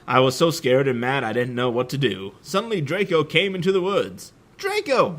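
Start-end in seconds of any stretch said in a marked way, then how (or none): 4.6-5.2 excited greeting. A narrator's reading. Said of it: "I said".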